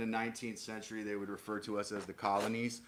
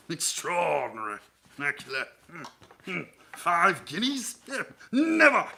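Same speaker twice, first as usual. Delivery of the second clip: blustering British businessman, pitched up, with chortles; fat Victorian British businessman; 6 semitones higher, 5 dB louder than usual. Acted. Streamer riffing as a British businessman, hammed-up chortling voice. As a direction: huff and chortle like a rich British gent. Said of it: "a fat nineteenth century British businessman".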